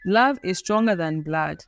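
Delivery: usual speaking voice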